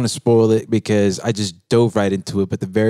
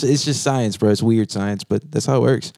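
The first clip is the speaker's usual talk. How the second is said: usual speaking voice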